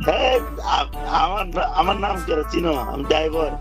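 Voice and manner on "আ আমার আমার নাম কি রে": normal talking voice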